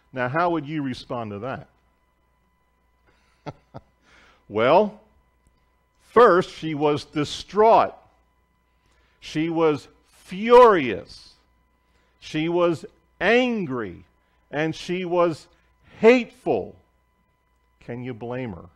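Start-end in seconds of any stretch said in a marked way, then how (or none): 6.1-16.7 slow, loud, each feeling word hammered; emphatic preaching. Acted. Preacher listing the missionary's feelings, slow and loud. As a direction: lay heavy weight on every feeling word.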